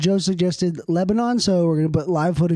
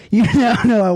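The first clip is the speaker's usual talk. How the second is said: laughing; 6 semitones higher, 7 dB louder than usual. Real, not acted. Laughing at the fly.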